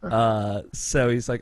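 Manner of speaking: ordinary talk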